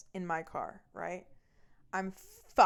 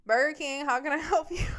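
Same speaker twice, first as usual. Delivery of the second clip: high, loud, sing-out greeting; drive-through order taker; 7 semitones higher, 7 dB louder than usual. Retold or imitated. Fast-food worker greeting the car at the window, high and loud. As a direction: call it brightly over a drive-through speaker.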